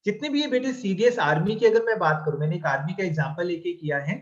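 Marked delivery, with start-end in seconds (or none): none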